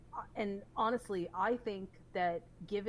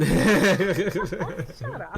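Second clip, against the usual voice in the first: laughing, loud and playful; laughing shut up; 15 dB louder than usual. Real, not acted. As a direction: shout it through a laugh. Laughing back at teasing host.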